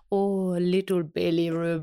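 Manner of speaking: slow mock British baby-talk to a cat; imitating Khan's accent. Boyfriend talking to her cat, slow imitated accent. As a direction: coo slowly in boyfriend's accent. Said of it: "and he goes".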